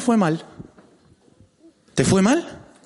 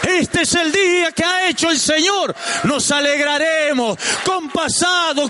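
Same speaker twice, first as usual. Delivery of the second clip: preaching at full force; 9 semitones higher than usual. Real, not acted. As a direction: proclaim it to the congregation with fire. (predicando a todo pulmón)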